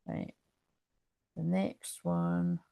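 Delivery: drowsy and slow; half asleep, dragging the words; sleepy. Real, not acted. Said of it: "like, so tired, I'm nearly sleeping".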